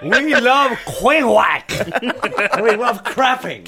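laughing through a mock lyric. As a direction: giggle the words out.

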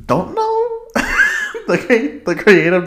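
high, laughing disbelief. Real, not acted. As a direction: laugh through the words. Laughing at the villain's design.

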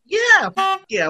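high, slow hammed-up yell; mock shout. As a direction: yell it like a cheering fan. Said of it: "Wikipedia says".

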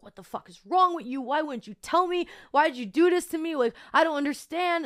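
high-pitched, fast-building retold tirade; angry cursing. Cursing out Francesco in her head while driving, angrily. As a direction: re-enact a hurt, angry demand for answers. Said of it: "kinda cursing out Francesco in my head".

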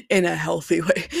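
incredulous echo of "In a healthy way" with a laugh; mocking repeat. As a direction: scoff at the phrase "In a healthy way". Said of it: "which I thought was hilarious".

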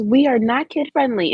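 playfully mimicking the sweet intro voice; mock kid-friendly voice. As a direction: imitate a sweet voice for small children. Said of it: "in the most kid-friendly voice possible".

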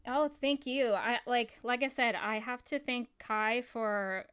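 normal talking voice

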